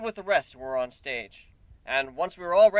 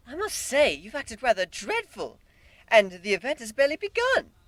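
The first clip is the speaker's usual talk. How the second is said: high, refined disapproval; prim scowling pony; 7 semitones higher than usual. Acted. Rarity scolding the humans, prim and high. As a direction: tut it out like a lady. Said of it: "Rarity scowled".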